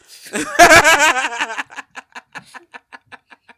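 loud, high burst of laughter; big belly laugh. Real, not acted. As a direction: crack up long and loud. Cracking up at own story.

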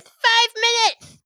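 high, loud and slow; eager minion calling out the time; high sidekick countdown; Steve's voice. Acted. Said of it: "He goes".

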